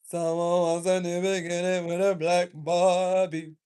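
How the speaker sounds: slow, loud, stretched-out repeat; loud, drawn out. Real, not acted. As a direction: draw every word out loudly.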